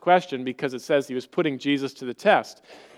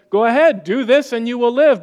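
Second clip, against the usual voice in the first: mock challenge; 6 semitones higher, 5 dB louder than usual. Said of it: "So Jesus says".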